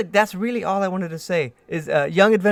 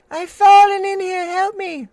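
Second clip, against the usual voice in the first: high, pitiful plea from below; a fake victim calling up; calling for help; 11 semitones higher, 5 dB louder, slower than usual. Sewer lure voice calling out.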